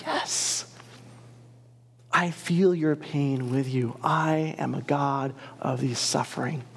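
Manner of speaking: tender reply. Acted. Voicing God's tender answer.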